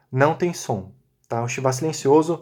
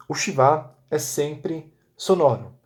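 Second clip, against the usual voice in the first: slow rule; slower than usual. Shown as a demonstration. Teacher dictating the rule to students, slowly. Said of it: "Debaixo da primeira letra de uma palavra".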